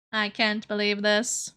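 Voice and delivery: normal talking voice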